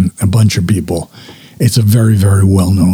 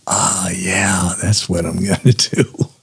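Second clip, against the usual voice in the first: sheepish realization; 3 semitones lower, 7 dB quieter than usual. Retold or imitated. Retold sheepish reply to husband.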